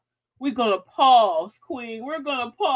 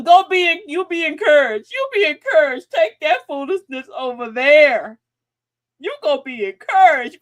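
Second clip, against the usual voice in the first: loud, higher be encouraged; loud dismissal; 4 semitones higher, 7 dB louder than usual. Shown as a demonstration. Host shooing away a foolish person, loud. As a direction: send them off with a raised voice. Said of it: "Tell them".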